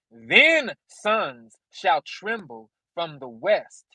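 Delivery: slow, drawn-out reading; solemn scripture reading. Acted. Preacher reading Hosea to viewers, slow and solemn.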